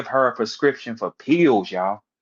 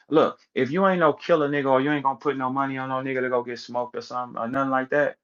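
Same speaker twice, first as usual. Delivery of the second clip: usual speaking voice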